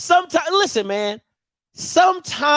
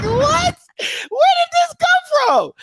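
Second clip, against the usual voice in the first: very high, drawn-out disbelief; squealed comic astonishment; incredulous outburst; slower than usual. Acted. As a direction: exclaim in hammed-up shock. Acts out own shocked reaction.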